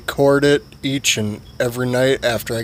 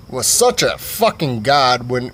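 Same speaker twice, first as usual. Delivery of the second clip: ordinary talk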